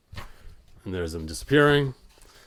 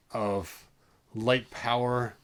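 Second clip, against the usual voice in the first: plain reading voice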